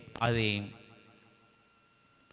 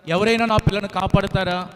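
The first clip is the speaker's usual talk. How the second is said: crying out for help; 4 semitones higher, 6 dB louder than usual. Acted. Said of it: "కేకలు పెడతా ఉంది".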